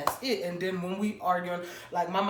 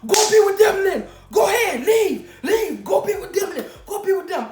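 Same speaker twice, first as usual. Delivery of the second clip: high, loud retold yelling through laughter; hammed-up angry boyfriend, much higher and louder; 11 semitones higher, 8 dB louder than usual. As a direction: yell it at him, half laughing at the memory.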